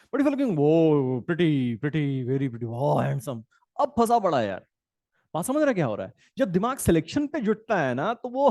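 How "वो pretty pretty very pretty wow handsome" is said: slow, drawn-out swooning; a chooser dazzled by every option, swooning; dreamy infatuation. Retold or imitated. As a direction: linger on each word like you're smitten.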